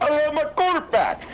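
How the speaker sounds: very high, hammed-up tearful wail; mock crying. Player crying on stage for attention, mock crying. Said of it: "Then you sit there and be like".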